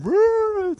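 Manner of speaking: high retold whoop; a high excited woo from the studio aisle; audience whoop. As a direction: cheer like a game-show crowd. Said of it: "in the aisle going".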